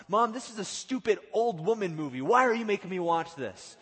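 loud, high mock outrage; acted childish protest. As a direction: whine at mom as an indignant kid. Voices himself as protesting child.

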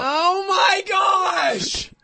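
high, loud, drawn-out shock. Real, not acted. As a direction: stretch it out, loud and high, in disbelief. Reacts to son tackling bully.

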